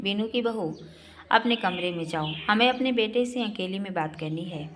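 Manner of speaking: ordinary delivery, nothing marked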